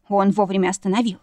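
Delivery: ordinary delivery, nothing marked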